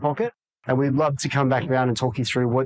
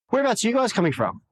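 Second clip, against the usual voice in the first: higher pitched, quick and breezy question; voice goes up; 8 semitones higher, faster than usual. Shown as a demonstration. Agent asking a buyer on the phone, higher pitched and quick. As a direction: lift the pitch to disarm them. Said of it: "your voice goes up. It's a higher pitch".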